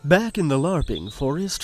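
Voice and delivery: ordinary talk, nothing marked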